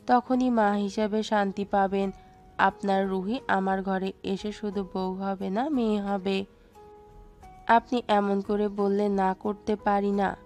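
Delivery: usual speaking voice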